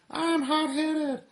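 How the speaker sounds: very high, slow tiny-cigarette voice; high slow cartoon cigarette, loud; Smoking Cigarette. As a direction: squeak it out like a tiny hothead cigarette. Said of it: "Smoking Cigarette tells the cows".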